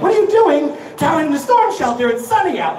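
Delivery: a hint of a wife catching him out; wife questioning. Wife asking why he's in the shelter, mock suspicious. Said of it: "my wife when she's like".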